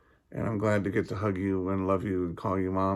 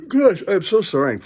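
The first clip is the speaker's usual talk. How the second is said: yawning laugh; 12 semitones higher, 9 dB louder than usual. Real, not acted.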